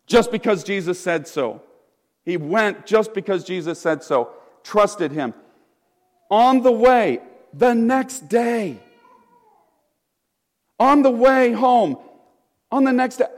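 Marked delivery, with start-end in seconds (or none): none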